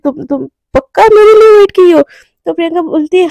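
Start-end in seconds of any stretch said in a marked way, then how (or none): 0.0-2.0 stammering, higher and louder; stunned, raised-voice double-check; shocked disbelief. A narrator's reading. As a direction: blurt it out in astonishment. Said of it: "एकदम shock हो जाती".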